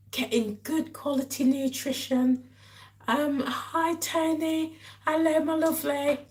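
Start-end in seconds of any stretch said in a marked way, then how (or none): none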